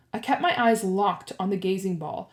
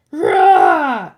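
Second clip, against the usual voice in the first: loud, raised "rah" roar; explosive bellow; monster roar; 7 semitones higher, 13 dB louder than usual. A narrator's reading. Medusa roars, not unconscious.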